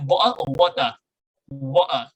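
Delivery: "bottle of water" with the t left unsounded; British swallowed t. Shown as a demonstration. Teacher shows the unread t, British "bottle of water". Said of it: "nggak kebaca botolnya, bukan botol".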